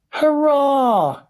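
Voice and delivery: high, loud cheer; jubilant cry. A narrator's reading. Mister Wonka celebrating the boy's return, jubilant cry. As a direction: cheer out loud, pitch right up. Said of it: "cried Mister Wonka".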